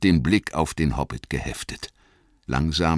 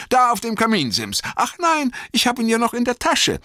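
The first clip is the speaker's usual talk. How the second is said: high, irritated retort, quicker at the end; testy little hobbit, pitched high and loud; Bilbo, gereizt; 5 dB louder, faster than usual. A narrator's reading. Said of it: "sagte Bilbo gereizt". (gereizt, mit hoher Stimme)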